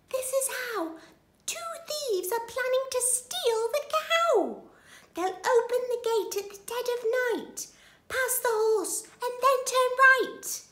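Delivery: very high little creature voice; tiny ladybird voice. A narrator's reading. Ladybird warning the farm animals, high tiny voice. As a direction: pipe up high like a tiny bug. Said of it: "the little spotty ladybird".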